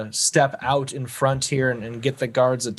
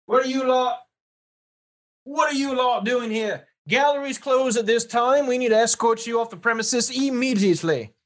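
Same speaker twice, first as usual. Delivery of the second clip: much higher-pitched British guard voice; 10 semitones higher than usual. Acted. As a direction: challenge intruders like an officious guard. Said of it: "this guard turns to you and says".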